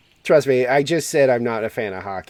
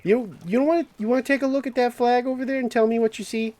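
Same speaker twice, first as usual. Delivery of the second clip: higher, fast mock challenge; 12 semitones higher, much faster than usual. Voicing the Canadian character's challenge.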